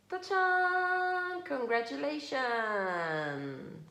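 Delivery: high, bright ta-da then slow congratulations; fanfare announcement. A narrator's reading. Reading to her child, announcing the baby's birth with a fanfare. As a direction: trumpet the big news, then stretch out congratulations.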